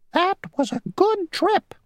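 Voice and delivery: very high, squeaky insect voice; a tiny, prim rule-keeper; a tiny mosquito insisting on his rule. Acted. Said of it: "said the mosquito".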